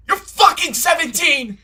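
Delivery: loud high yell. Shown as a demonstration. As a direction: scream it at them. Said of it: "Is that better?".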